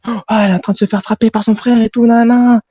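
panicked friends. Retold or imitated. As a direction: squeal it out like frightened friends. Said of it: "elles étaient en panique". (copines paniquées, voix bien plus aiguë)

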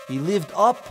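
put-on high, loud storyteller voice for children; animated kids' storyteller; dramatic story narration. A narrator's reading. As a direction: spin the tale with big drama.

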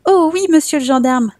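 put-on high, excited little boy's voice; eager small child. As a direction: grab the offer like a delighted child. Child Jean eagerly answers gendarme.

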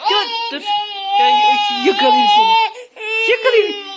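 excited mock-threatening coo; playful threat. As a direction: tease like you're about to pounce. Chase game.

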